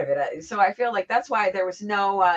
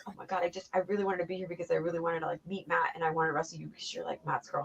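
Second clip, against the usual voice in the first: quiet imitated crying; 3 semitones lower, 10 dB quieter, faster than usual. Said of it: "she started crying, and she was like".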